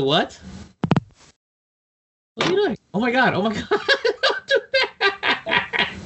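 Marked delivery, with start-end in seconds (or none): 2.3-5.9 higher, laughing in disbelief; real giggles at the cohost's antics. Real, not acted. Watching cohost clown on camera, laughing.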